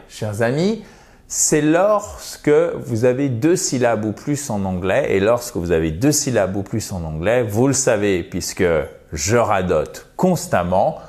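ordinary talk

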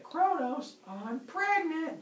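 high-pitched, drawn-out announcement; singsong mock-feminine delivery, slowly; excited wife. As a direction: put on a high wifely voice. Voices wife announcing pregnancy.

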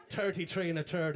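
imitated Irish accent, th turned to t; Cork accent. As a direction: mimic a Cork accent on "Thirty-three and a third". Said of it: "Fucking love that accent".

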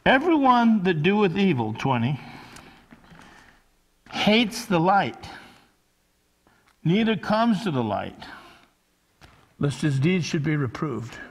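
loud, measured Bible reading; scripture reading. Acted.

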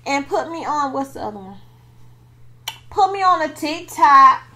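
slow, loud sermon-style pitch; preachy plug. Pretend. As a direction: preach it to the viewers.

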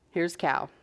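plain reading voice

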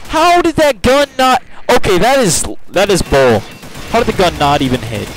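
loud, high indignant protest easing slightly; real gamer rage; angry outburst. Real, not acted. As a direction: protest loudly in disbelief at the game. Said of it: "Sorry, I'm angry".

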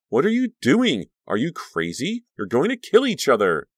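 high, loud, frantic scolding; alarmed yelling. A narrator's reading. Caroline breaking up a fight, alarmed yelling. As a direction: yell at them in disbelief. Screaming at friends to stop fighting.

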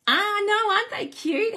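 real thrilled squeal; excited squeal. Real, not acted. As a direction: gush uncontrollably over the cows. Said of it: "I got very excited about my cows".

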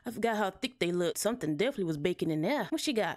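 ordinary talk, nothing marked

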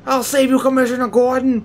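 high, loud hammed-up hero call; heroic Superman. Player voicing Superman rescuing Gordon, loud hammed-up heroics. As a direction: proclaim the rescue like a cartoon hero.

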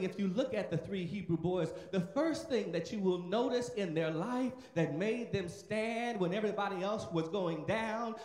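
normal talking voice

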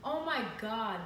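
ordinary talk

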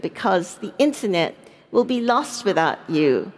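ordinary delivery

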